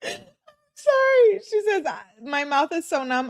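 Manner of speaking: high squeal settling as she reads on; fighting giggles, pitch starting very high; real laughter. Real, not acted. Reader cracking up at the numb-mouth text, laughing. Breaks up laughing while reading texts.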